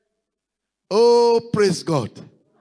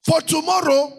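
preaching; 7 semitones higher than usual. Acted. Pastor urging the congregation to pray ahead, rising preaching cry. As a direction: drive each line home to the congregation.

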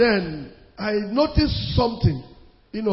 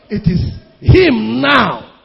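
slow emphatic; 8 dB louder, much slower than usual. Real, not acted. Preacher stressing God's power.

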